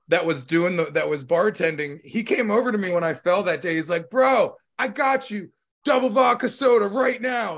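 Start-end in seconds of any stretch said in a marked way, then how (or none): 4.1-7.6 eager bartender. Retold or imitated. Quoting bartender.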